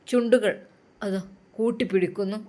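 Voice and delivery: plain reading voice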